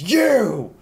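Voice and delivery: high, loud, drawn-out yell; in-character intimidating scream; screaming battle threat. Acted. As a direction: scream it at the top of your lungs. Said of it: "screams at the top of the, her lungs".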